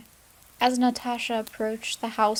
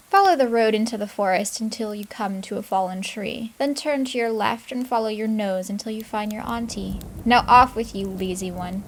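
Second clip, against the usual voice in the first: put-on louder, commanding stepmother, shooing the girl off; harsh stepmother; 7 dB louder than usual. Stepmother sends the girl into the forest, loud and harsh. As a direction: bark the orders like a cruel stepmother.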